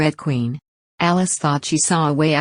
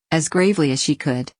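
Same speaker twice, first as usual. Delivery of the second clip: ordinary delivery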